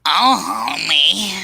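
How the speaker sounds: high, nasal wife's whine; Marge impression. Marge calling Homer in a high whine. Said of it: "I can't do her voice".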